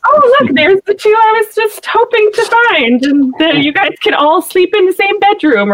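hammed-up delighted old lady; mock sweet aunt. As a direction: ham up a too-convenient happy discovery. Mocking Aunt May's convenient line.

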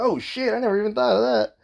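high-pitched dawning realisation; retold surprise. Reacting to a friend's past life theory, retold surprise.

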